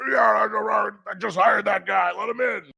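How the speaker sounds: high, loud, casual mock voice of Doc; loud high mimicry of a coworker; imitating Doc. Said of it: "Doc's like".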